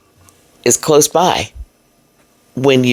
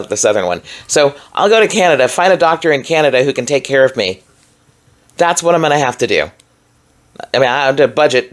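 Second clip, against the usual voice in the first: usual speaking voice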